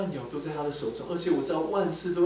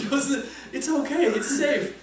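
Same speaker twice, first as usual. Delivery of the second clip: laughing reassurance; 11 semitones higher, 7 dB louder, slower than usual. Retold or imitated. Reassuring scared coworker, laughing.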